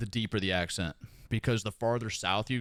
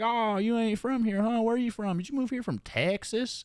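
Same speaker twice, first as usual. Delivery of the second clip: high-pitched imitation of a nosy stranger; 10 semitones higher than usual. Stranger at the store asking where you're from, high mock-curious voice. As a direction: imitate a prying local, pitched well up.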